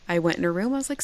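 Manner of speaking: ordinary delivery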